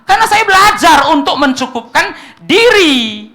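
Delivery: preacher to congregation, quoting Paul with rising fervour; impassioned preaching. Acted. Preacher builds to impassioned quote.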